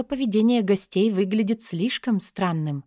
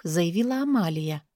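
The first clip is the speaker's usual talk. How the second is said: usual speaking voice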